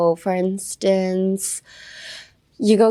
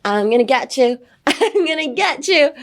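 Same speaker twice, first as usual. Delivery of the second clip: mock threat; 6 semitones higher than usual. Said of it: "start a fight with somebody".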